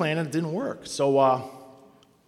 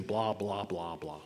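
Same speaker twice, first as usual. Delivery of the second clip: dismissive; 7 semitones lower, 11 dB quieter, slower than usual. Retold or imitated. Dismissing recited recovery slogans.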